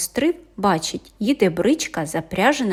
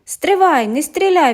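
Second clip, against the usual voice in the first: much higher and louder call; urgent shout; 8 semitones higher, 6 dB louder than usual. A narrator's reading. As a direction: cry out to stop him shooting. Riders shout back to sentry. (гучний переляканий вигук)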